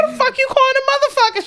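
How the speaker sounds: high mock-furious who you calling; indignant comeback. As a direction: fire back, ready to fight. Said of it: "She was about to start scrapping".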